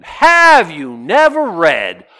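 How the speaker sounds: slow, loud and cutting; loud, scornful and drawn out; scornful retort. Retold or imitated. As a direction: lean on it like talking to a fool. Jesus's cutting retort to scribe.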